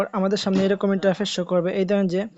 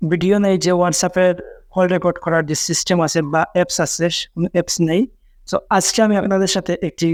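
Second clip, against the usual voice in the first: louder, energetic host pitch to viewers; upbeat YouTube intro; 5 dB louder than usual. Acted. YouTuber hyping his channel to viewers, louder announcer style.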